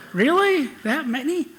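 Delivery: high, amused disbelief at the show of hands; surprised. Real, not acted. To the audience who hear his accent, high surprised disbelief.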